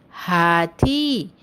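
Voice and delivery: हाथी with both vowels stretched long; drawn-out teacher's demo of हाथी. Shown as a demonstration. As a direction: say हाथी very slowly, holding the final ee. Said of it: "शब्दों का उच्चारण थोड़ा लंबा होगा".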